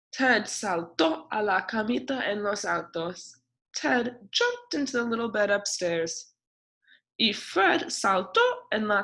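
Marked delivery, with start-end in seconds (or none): none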